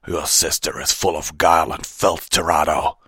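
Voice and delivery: loud, furious accusation; snarled. Acted. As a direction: snarl it with contempt. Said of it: "Fenrir snarled".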